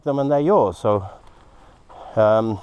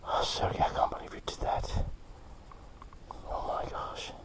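breathless whisper of disbelief; awed whisper; 14 dB quieter than usual. Real, not acted. Whispering in awe to Sergey about his Moldavite. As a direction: whisper it, stunned by his find.

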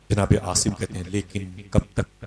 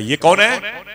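sharp, loud and high "who is this?"; angry demand; 11 semitones higher, 7 dB louder, much slower than usual. Retold or imitated. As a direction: bark it at the unknown child. Father sees the girl, angry. (गुस्से में ऊँची आवाज़ में पूछना)

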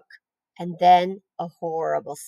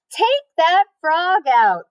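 high, slow bossy order; big sister giving an order; bossy girl; 9 semitones higher, 3 dB louder, slower than usual. A narrator's reading. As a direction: boss the little brother around. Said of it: "ordered Fern".

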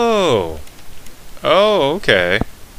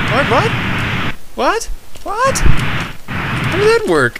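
disbelief; 12 semitones higher, slower than usual. Real, not acted. Baffled by game physics.

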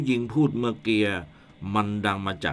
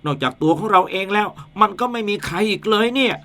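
higher, stuttering frightened voice; scared stammering; 6 semitones higher than usual. Acted. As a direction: stammer in rising panic. Man hears invisible ghost.

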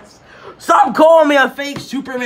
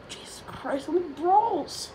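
whisper; 8 semitones higher, 10 dB quieter than usual. Acted. Exasperated after another brawl.

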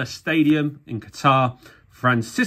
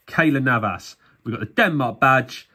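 ordinary talk

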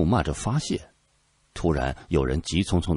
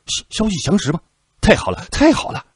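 very high, loud stammering joy; overjoyed; 13 semitones higher, 6 dB louder than usual. A narrator's reading. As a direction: burst out in delight. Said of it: "顿时大喜".

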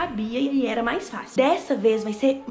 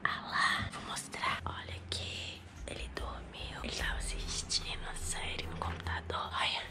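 hushed whisper so he won't wake; whisper, careful not to wake anyone; 8 dB quieter than usual. Real, not acted. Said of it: "eu já tô falando baixo porque ele tá dormindo".

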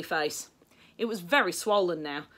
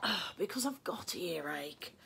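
soft, slow, wincing in pain; hushed, suffering reply; 11 dB quieter, slower than usual. A narrator's reading. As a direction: groan it weakly with earache. William in pain from earache.

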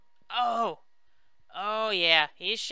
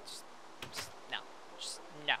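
muttered refusal; 5 semitones lower, 14 dB quieter than usual. Real, not acted. Refusing the rain in game.

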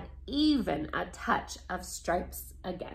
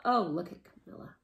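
ordinary delivery, nothing marked

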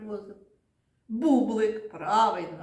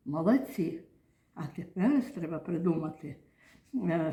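normal talking voice

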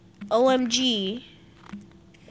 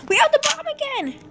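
excited; 6 dB louder than usual. Real, not acted. Excited about carrying the bomb.

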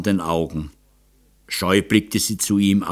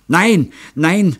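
stammering; 8 semitones higher, 3 dB louder than usual. A narrator's reading. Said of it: "stammelte Gundi Glesberg".

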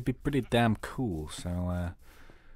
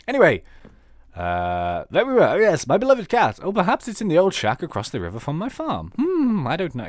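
lady with a lost cat; 4 semitones higher, 7 dB louder than usual. Acted. Reads NPC lady's lines again.

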